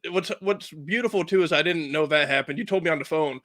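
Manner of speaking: ordinary talk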